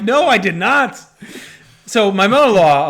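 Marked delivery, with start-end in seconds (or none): 0.0-1.0 higher, louder mock protest; indignant denial. Father denying the claim to mother-in-law, indignantly. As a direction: act out an emphatic, wronged denial.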